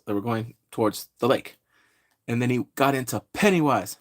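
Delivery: usual speaking voice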